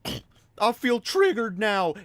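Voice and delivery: mock a self-pitying parent; disappointed parent. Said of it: "you see parents do this all the time".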